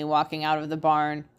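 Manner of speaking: ordinary delivery, nothing marked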